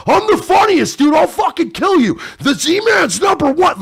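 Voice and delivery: high-pitched, loud mock tantrum; mock meltdown. Mocking touchy streamers who can't take a joke, hammed-up screaming meltdown. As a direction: shriek it like a thin-skinned performer losing it. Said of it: "they immediately melt down and go".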